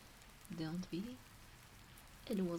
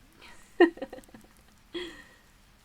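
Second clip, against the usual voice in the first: bright amused breathy giggle; playful laugh; 10 semitones higher, 9 dB louder than usual. Acted. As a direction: laugh fondly at the pet. Amused at the pet woofing.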